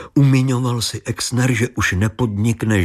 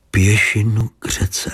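plain reading voice

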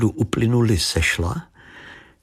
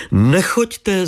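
higher, calling from the window; 10 semitones higher, 3 dB louder than usual. A narrator's reading. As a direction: stop him leaving, called out high. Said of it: "zavolala paní Věra". (hlasité zavolání z okna)

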